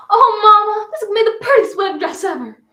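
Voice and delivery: put-on high, loud girlish gush; excited bride-to-be. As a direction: gush to Mama with breathless delight. Young bride gushing to her mother.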